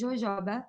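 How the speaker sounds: jojoba as "zho-ZHAH-bah"; Portuguese pronunciation. Shown as a demonstration. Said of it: "But it's jojoba".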